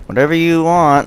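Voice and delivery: lilting high imitation; imitated girl. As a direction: mimic the girl, pitched well up. Girl's reply, imitated, against his own.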